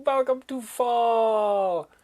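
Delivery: a slow, low-voiced welcome; drawn-out low welcome. Shown as a demonstration. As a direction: sink the voice and slow right down. Repeated greeting, slowed and lowered.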